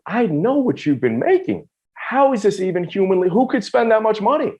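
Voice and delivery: high-pitched retold disbelief from a mother; incredulous mom. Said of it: "And she's like".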